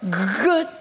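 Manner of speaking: put-on stuttered old-man reply; shaking elderly man. Old boss approves new hire, mild stammer. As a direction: stammer lightly on the first sound. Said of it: "this man is kind of shaking".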